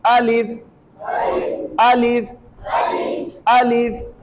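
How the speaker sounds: अलिफ said crisp and short, vowel not stretched; short alif. Shown as a demonstration. Teacher modelling a short, correct अलिफ. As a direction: clip अलिफ short and firm. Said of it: "फा साकिन है".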